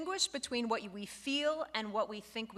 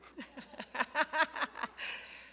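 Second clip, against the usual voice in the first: breathy laugh; 5 semitones higher than usual. Real, not acted. Teacher laughs at her own mock insult. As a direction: laugh it off under your breath.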